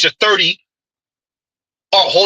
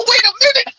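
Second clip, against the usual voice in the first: squeaky laugh into "Wait a minute"; faster than usual. Real, not acted. Host cracking up at the clip, laughing out the words. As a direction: laugh through the words in disbelief.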